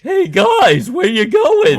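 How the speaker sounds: high, loud Ernie voice; Ernie impression. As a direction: call after them like Ernie from Sesame Street. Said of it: "good Ernie impression".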